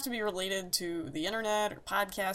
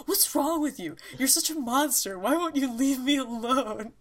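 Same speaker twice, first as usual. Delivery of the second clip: higher, exasperated wail; flipping out girl; 6 semitones higher, 3 dB louder than usual. Retold or imitated. Girl flipping out at a teasing boy, higher and exasperated. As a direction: whine it out like she's at her wits' end. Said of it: "she'd be like".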